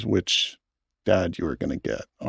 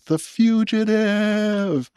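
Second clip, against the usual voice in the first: high, drawn-out mock scream of triumph; imitated triumphant scream; 11 semitones higher, much slower than usual. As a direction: imitate an excited dad crowing it, stretched long and high.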